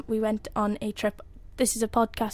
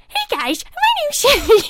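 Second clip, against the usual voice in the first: high, bright tour guide chirp, much higher and louder; Cindy voice; 8 dB louder, slower than usual. Retold or imitated. Doing the boat guide Cindy for the podcast, hammed-up chirpy. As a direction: play a chirpy theme park boat guide, pitched way up. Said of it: "should I do the voice of Cindy".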